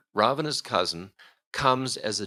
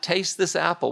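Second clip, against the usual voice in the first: mock temptress; 9 semitones higher, 3 dB louder than usual.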